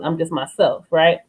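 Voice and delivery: ordinary delivery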